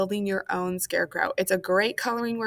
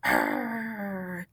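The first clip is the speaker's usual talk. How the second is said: scary growl; 2 semitones lower, 4 dB quieter than usual. Shown as a demonstration. Librarian making a scary face for kids, playful mock growl. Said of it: "Let's see that big scary face".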